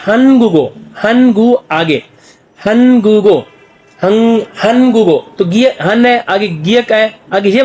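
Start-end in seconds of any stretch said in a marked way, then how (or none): 0.1-5.3 हनगुगो linked with a g before ओ, drawn out; correct reading. Shown as a demonstration. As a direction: say हनगुगो slowly with the g sliding onto ओ. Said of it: "तो क्या बोलेगा?".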